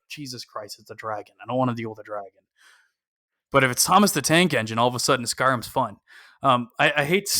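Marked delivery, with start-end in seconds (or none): 0.1-2.4 soft, deflated mock grumble; weary player. Bored player facing another dragon, weary grumble. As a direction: grumble it quietly, imitating a fed-up player. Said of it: "And you're like".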